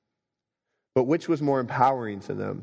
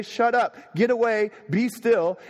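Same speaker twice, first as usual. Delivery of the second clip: commanding rebuke; 10 semitones higher, 3 dB louder, slower than usual. Acted. Brother rebukes the storm.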